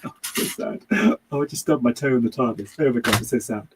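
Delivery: a lightly voiced, hammed-up sobbing Doctor, breathy sigh first; mock weepy Doctor. Mocking the crying Doctor, a hammed-up weepy whine. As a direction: whine it out like a melodramatic hero over a stubbed toe. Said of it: "doesn't sound like that, obviously".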